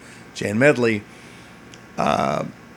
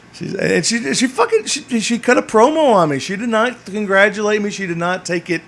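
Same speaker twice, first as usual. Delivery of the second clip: excited; 9 semitones higher, 5 dB louder than usual. Real, not acted. Excited retelling of being chewed out.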